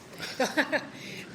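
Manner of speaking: light unvoiced chuckle; amused little laugh at the question. Real, not acted. Laughs after naming Tommaso.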